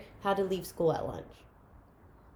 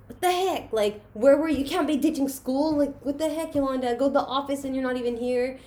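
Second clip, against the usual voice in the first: higher, louder mock scolding dad; mock angry dad; 5 semitones higher, 3 dB louder than usual. Dad scolding his daughter for ditching school, mock angry. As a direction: scold her like an exasperated father. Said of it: "my dad came in and was like".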